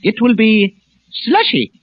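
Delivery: grave, measured newsman with weighty pauses; news commentator impression. Comic at supermarket show, solemn news commentator voice. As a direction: read it like a solemn radio commentator. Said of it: "impersonation will be a well-known news commentator".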